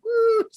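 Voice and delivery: high-pitched mock "woo"; high whoop. Fan imagining a diving headbutt, high whoop. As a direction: let out a showy wrestling whoop.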